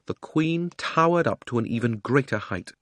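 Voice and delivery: usual speaking voice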